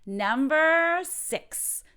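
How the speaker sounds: high, very slow, loud list call; drawn-out announcement. Shown as a demonstration. Teacher announces the next phrase, drawn-out high call.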